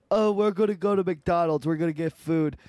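high, loud mock twin voice; loudmouth twin. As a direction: announce it like a loudmouth. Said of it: "they're all loudmouth".

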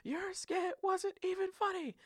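very high, drawn-out squawk at no great volume; mock scream. Imitating bandmate raging after losing skit night, high mock scream. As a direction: imitate a furious tantrum in falsetto. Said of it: "screaming on top of his lungs".

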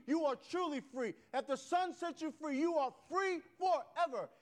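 loud, high, ringing sermon climax; preaching at full force. Acted. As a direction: proclaim it to the back pew.

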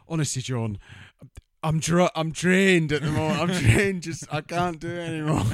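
high, loud, exhausted put-on voice; hammed-up worn-out whine; drained mock plea. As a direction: moan it like a spent man begging off. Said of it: "And he goes".

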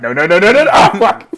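high, loud, laughing panic; panicked yelling. Retold or imitated. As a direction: scream as you're hunted down. Panicking as Reza chases him.

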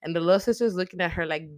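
ordinary talk, nothing marked

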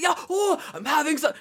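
high loud eager exclaim; excited shout. Retold or imitated. Retelling the bus driver loving Queen, loud glee.

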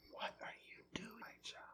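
barely audible whisper; small voice whisper. Acted. God's voice coming to Elijah at the cave, whispered. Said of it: "Do you hear that small voice?".

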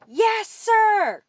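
high, drawn-out cadet reply; loud shout. A narrator's reading. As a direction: bellow it back to the leader. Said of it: "shouted loudly".

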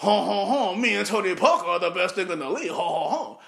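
French impression. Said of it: "you wanna, you know".

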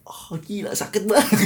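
acted shock, high-pitched and half laughing; shocked pain. Someone who really fell from the stairs, shocked pain. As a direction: act startled by how much it hurts, voice lifting. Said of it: "itu pasti tetap kaget rasanya".

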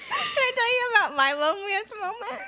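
giggling through the words; high, laughing delivery. Real, not acted. Laughing, teary setup of a story.

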